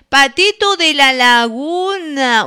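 slow, drawn-out radio DJ title call; song announcement. Real, not acted. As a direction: stretch the title like a radio host. Radio host announcing the next song.

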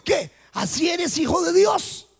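high, doubting question from the tempter; tentador incrédulo. Acted. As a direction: question it in disbelief, rising high. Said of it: "quiere meter la duda". (tentador incrédulo, con pregunta aguda)

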